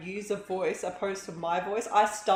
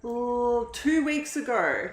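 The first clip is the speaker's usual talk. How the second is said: excited; 6 semitones higher, 5 dB louder than usual. Real, not acted. Excited telling viewer about top.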